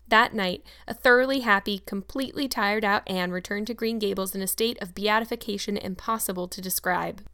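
ordinary delivery

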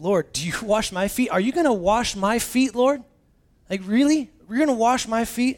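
can't believe the Lord would do this; Peter, shocked and incredulous; astonished Peter. Acted. Peter voiced, astonished at Jesus.

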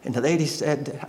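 ordinary delivery

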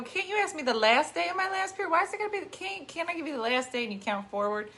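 mock indignant complaint; exasperated protest. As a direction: argue back, mildly exasperated, words tumbling into each other. Retold protest to doctor's question.

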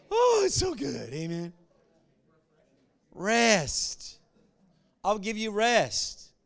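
loud, impassioned scripture quote; fervent preaching. Acted. Preaching call and response.

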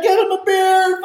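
high, loud, cheerful call; gleeful mock order. Bikers welcoming him, high and loud with glee. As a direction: toss it out like a rowdy biker.

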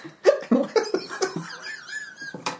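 very high hiccuping giggle; squeaky character laugh. Acted. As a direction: giggle like a silly construct. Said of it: "That's his new laugh".

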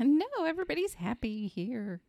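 high, put-on reassurance; mock cheerful nurse. Joins in as the falsely cheerful nurse.

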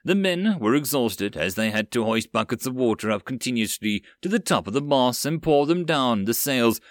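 ordinary delivery, nothing marked

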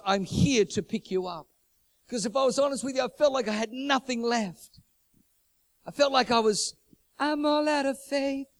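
high, loud, driving sermon delivery; fiery revival preacher; full-force preaching. Acted. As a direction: preach it out to the whole room. Preaching continues.

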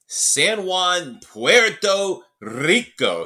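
slow, rolled Puerto Rican Spanish accent on "Puerto Rico"; Spanish accent attempt. Shown as a demonstration. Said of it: "I can't do the accent".

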